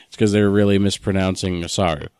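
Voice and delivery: usual speaking voice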